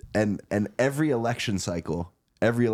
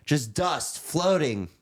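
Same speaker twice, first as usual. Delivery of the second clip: Michael's speech voice; 6 semitones higher, slower than usual. Retold or imitated. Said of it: "he talked like this".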